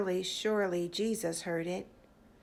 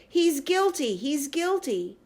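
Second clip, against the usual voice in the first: screaming crowd; 6 semitones higher, 4 dB louder than usual. Acted. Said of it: "People were screaming".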